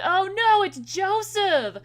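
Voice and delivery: loud, slow disgusted groan; disgusted shout. Acted. Disgusted at seeing Joseph.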